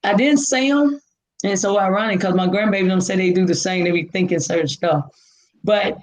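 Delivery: ordinary talk, nothing marked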